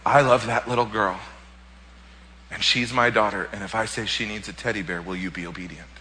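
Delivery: the Lord asking about his daughter, softly; gentle voice of the Lord. Retold or imitated. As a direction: speak as a loving father, quietly. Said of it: "I heard audibly from the Lord".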